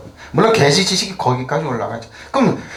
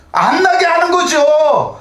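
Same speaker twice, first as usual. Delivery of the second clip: drawn-out, much higher and louder outcry; indignant high cry; 13 semitones higher, 6 dB louder, much slower than usual. Real, not acted. Preacher protesting a priest's words, indignant slow high cry. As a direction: let the outrage stretch each syllable.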